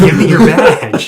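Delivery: high, loud, laughing badge demand; hammed-up homeless lady grabbing for the badge; shrill demanding lady. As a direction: squeal it at them, half laughing. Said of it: "She's like".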